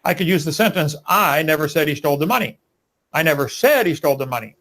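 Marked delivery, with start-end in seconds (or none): 1.1-2.6 "I" stressed, the rest falling away. Shown as a demonstration. Debater shows how emphasis changes meaning, stress on "I". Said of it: "By just emphasizing different words". 3.1-4.6 "said" punched, then falling; stress on SAID. Shown as a demonstration. Debater shows how emphasis changes meaning, stress on "said". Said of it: "By just emphasizing different words".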